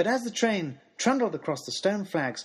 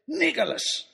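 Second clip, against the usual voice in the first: Nicholas sung out high, pleased; triumphant name; 10 semitones higher, slower than usual. Acted. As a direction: announce Nicholas with delight. Triumphant naming.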